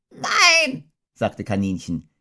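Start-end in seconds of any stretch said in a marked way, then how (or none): 0.1-0.9 very high, squeaky put-on no; disguised voice. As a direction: Rabbit faking a different voice. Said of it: "diesmal mit einer anderen Stimme".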